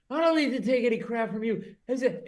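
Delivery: hammed-up drunk rant; angry drunk. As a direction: snap at the comic like a bleeding drunk. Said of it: "he goes".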